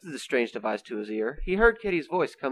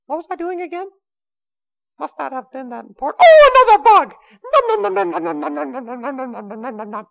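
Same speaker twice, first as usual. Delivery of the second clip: very high, thin voice that climbs to a loud squeal; a scatterbrained little critter who has spotted a snack; squeaky bug-chaser voice; 14 semitones higher, 13 dB louder than usual. Acted. Kitty lost in the forest, chasing another bug, very high and squeaky. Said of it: "he said as he chased the new bug".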